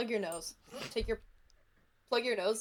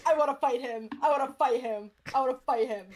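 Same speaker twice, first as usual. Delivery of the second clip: loud, eager, repeated demand; eager shout; 3 semitones higher, 8 dB louder than usual. Real, not acted. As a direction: chant it, itching for a fight. Eager to fight Jeremy.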